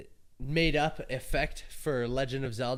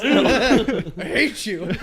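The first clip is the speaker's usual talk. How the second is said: laughing; 9 semitones higher, 11 dB louder, much slower than usual. Real, not acted. Laughing mock complaint.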